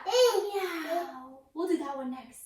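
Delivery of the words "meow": high, drawn-out playful meow; cat meow. Pretend. Teacher exhaling into cat pose, playful meowing. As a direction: mew like a cat for a child. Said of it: "exhale into cat".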